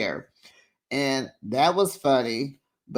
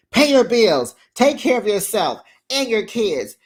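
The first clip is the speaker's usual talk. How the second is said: imitating a stern lecture, louder; mock scolding; 6 semitones higher, 4 dB louder than usual. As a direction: bark the orders like Gina, half-acted. Acted Gina lecturing Jen.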